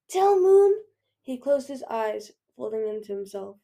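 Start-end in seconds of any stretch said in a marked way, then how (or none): 0.1-0.7 high, loud, urgent plea; desperate last-message cry; gasped plea. A narrator's reading. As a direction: force it out as if hurt. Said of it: "Winter gasped".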